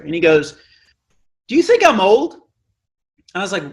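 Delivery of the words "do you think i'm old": much higher, a bit louder question; old man asking. Retold or imitated. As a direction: ask him like a curious old man. Old man's question, retold.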